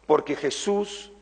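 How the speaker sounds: ordinary talk, nothing marked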